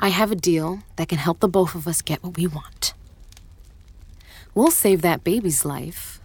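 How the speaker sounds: low unvoiced whisper, conspiratorial. Plotting rebel whispers a deal to the guard. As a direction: whisper the offer so no one hears. Secret deal offered in hiding.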